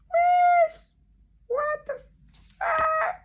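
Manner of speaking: very high, loud squeak; piercing high-pitched disbelief; shocked squeal. Acted. Shocked at her tiny old handwriting.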